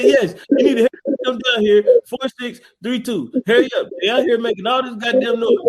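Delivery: hammed-up caller, laughing through it; mock phone call. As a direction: play an old man calling 911. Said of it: "he said".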